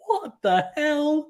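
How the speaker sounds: very high, drawn-out disbelief; incredulous. Real, not acted. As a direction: squeal it slowly in stunned amazement. Stunned at the replay.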